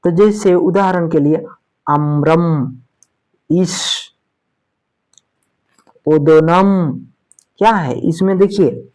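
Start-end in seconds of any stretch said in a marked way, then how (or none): 1.9-6.9 अम्रम, इस, ओदोनम with the first vowel drawn out; lengthened vowels. Shown as a demonstration. Teacher giving examples अम्रम, इस, ओदोनम with long vowels drawn out. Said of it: "थोड़ा लंबा जा रहा है".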